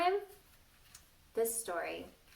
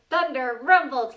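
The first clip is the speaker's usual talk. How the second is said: booming, much louder "Thunder rumbled"; thunder rumble; 5 semitones higher, 13 dB louder than usual. Shown as a demonstration. Teacher showing children how to make thunder, booming. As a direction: boom it out like thunder. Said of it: "make thunder like this".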